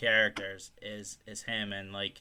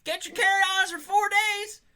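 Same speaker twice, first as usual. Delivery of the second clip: high, loud cartoon governor rounding up family; hammed-up squeaky shouting; high silly voice; 7 dB louder than usual. Acting governor herding family.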